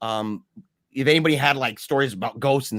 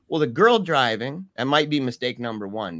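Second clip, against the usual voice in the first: usual speaking voice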